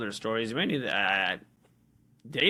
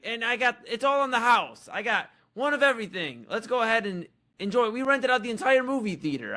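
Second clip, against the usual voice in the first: high, hammed-up show-off voice, pitched way up; mock boastful date; 9 semitones higher than usual. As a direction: ham it up as a bragging date showing off. Mocking date's boastful voice.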